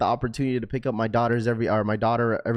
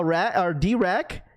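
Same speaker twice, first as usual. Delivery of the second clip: ordinary talk, nothing marked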